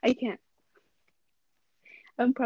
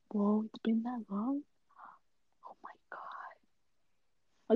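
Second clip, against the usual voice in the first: breathless stunned whisper; shocked whisper; 7 dB quieter, much slower than usual. Real, not acted. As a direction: whisper your disbelief.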